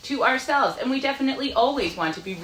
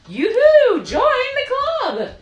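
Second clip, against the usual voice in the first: much higher, louder, slow cheer; joyful shout; 7 dB louder, slower than usual. Acted. The other four welcoming the stork, a high loud cheer. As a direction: sing out a happy welcome call. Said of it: "the other four shouted".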